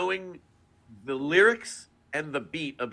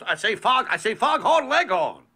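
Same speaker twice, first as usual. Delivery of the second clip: higher, louder Southern rooster voice; Foghorn Leghorn; 7 semitones higher, 5 dB louder than usual. Shown as a demonstration. Showing how the voice becomes Foghorn Leghorn, loud Southern rooster. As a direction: boom it out like a blustering Southern rooster. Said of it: "talking like you're from the South".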